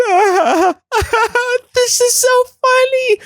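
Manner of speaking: very high, squealed mock laughter; hysterical laughing brain. Brain of someone drowning in laughter, high hysterical squeal. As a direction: act out a brain helplessly giggling, voice way up. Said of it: "when you can't stop laughing".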